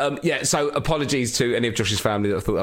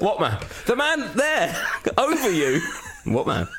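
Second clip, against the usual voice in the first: laughing mock denial; 8 semitones higher than usual. Mocking Rose's denial, laughing.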